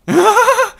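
sudden burst of laughter; loud laugh. Real, not acted.

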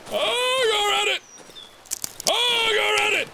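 very high, strained plea; panicked squeal. Real, not acted. Urging character past monster.

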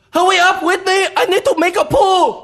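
impatient shout. Acted. Joke punchline shouted at door.

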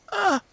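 short, high, loud "Ah!"; a sudden high yelp from someone just hit; woman's cry. Acted. Game master voicing the struck enemy, high cry. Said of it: "what sounds like a woman's voice go".

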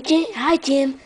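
usual speaking voice

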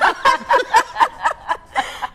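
high, loud burst of laughter; embarrassed laugh. Real, not acted. Host laughs after co-host calls the accent awful, loud high laugh.

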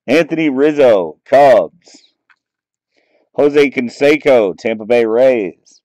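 ordinary delivery

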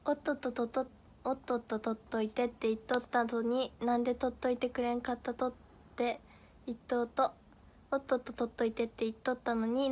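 a quick Nagasaki dialect tongue twister; a hint of Nagasaki dialect. Shown as a demonstration. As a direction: rattle off the Nagasaki phrase. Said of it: "あ、長崎弁なんだ".

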